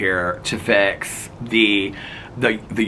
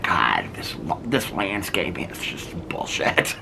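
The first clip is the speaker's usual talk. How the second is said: grumbling husband; 6 semitones lower, 6 dB quieter, slower than usual. Retold or imitated. Imitating grumpy husband.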